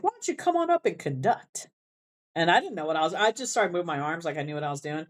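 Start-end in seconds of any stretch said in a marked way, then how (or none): 0.0-1.7 a high, friendly invite to come conduct; director's invitation. Retold or imitated. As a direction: call the student up with a lift. Band director inviting him.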